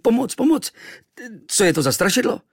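very high, loud panic; frightened cry for help. A narrator's reading. Boy wakes facing a huge tattooed stranger, panicked. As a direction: yelp in alarm at a spook. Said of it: "Vyrazil jsem ze sebe".

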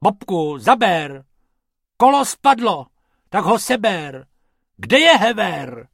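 loud, high squabbling orders; quarrelling rabbits. Acted. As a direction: bicker while you work. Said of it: "trochu se hádali".

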